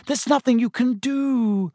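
high-pitched, stricken helplessness. A narrator's reading. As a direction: plead helplessly, pitched well up. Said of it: "looking stricken".

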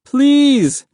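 very high, drawn-out loud plea; begging. A narrator's reading. Boy in the water to the dinghy, desperate begging. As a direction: cry it out desperately. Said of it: "Mahmud begged".

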